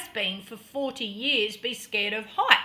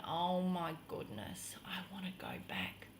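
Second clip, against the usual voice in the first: hushed, low whisper of dread; frightened whisper; 8 semitones lower, 15 dB quieter than usual. Retold or imitated. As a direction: whisper it as if frozen on the ledge. Relives dread on the ledge.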